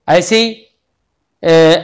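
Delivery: ordinary talk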